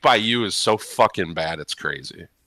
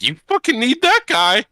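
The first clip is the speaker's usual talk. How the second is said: mocking Blake; 4 dB louder than usual. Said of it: "Blake's like".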